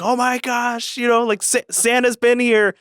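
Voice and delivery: put-on high, breathless childlike excitement; mock kid voice, way up high; excited kids. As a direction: squeal it like a thrilled kid. Kids excited on Christmas morning.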